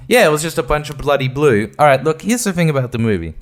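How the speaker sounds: usual speaking voice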